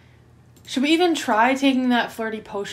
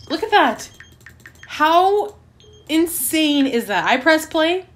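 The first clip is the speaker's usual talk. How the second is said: incredulous outburst; 7 semitones higher, 7 dB louder, slower than usual. Real, not acted. Ranting at the game.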